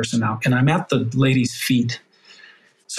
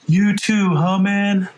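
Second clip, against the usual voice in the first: tearful high question; 7 semitones higher, much slower than usual. Retold or imitated. Firefighter to captain after both cried, high and tearful. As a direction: ask it through tears, half laughing.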